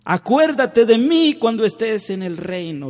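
high, loud begging; thief's plea. Retold or imitated. Thief on the cross begging Jesus, high loud plea. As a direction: beg to be remembered, full-voiced. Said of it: "Hasta los ladrones suplicaban".

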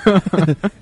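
bright burst of giggling; high giggle. Real, not acted. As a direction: laugh at your own joke. Laughing at his own boast.

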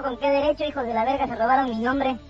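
ordinary talk, nothing marked

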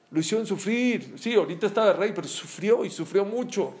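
ordinary talk, nothing marked

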